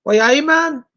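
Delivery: put-on Geordie catchphrase; Geordie accent. Shown as a demonstration. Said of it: "I pass with my Geordie speak".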